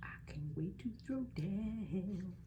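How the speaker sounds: slow eager whisper; excited whisper. Real, not acted. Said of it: "whispered excitement".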